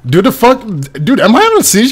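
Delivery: high-pitched, incredulous questioning; baffled protest. Real, not acted. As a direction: protest in disbelief, pitch jumping up. Indignant at being accused of screaming.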